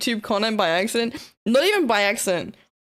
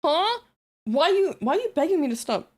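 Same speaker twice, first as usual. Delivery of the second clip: high, puzzled questioning; puzzled, pitched-up questions; confused; 7 semitones higher than usual. Real, not acted. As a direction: ask it high, genuinely baffled. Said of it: "I'm really confused".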